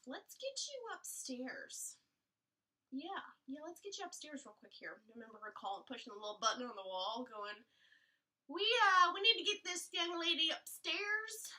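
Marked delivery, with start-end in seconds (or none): 0.1-5.0 nurse. Retold or imitated. Nurse surprised at progress. 8.5-11.5 loud, high nurse call over the intercom; urgent nurse call. Retold or imitated. Nurse paging over the intercom, loud and urgent.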